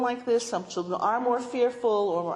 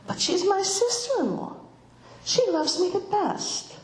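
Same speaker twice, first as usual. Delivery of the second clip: lightly voiced child, higher pitch; secure child; 8 semitones higher than usual. Child sure of her sister-in-law's love, lightly childlike.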